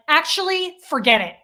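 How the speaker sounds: withdraw the kindness in annoyance; re-enacted irritated outburst; pissed-off retort. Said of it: "I get so pissed".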